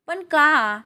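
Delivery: indignant "but why?" delivery; protesting question. A narrator's reading. Daughter protests being kept home.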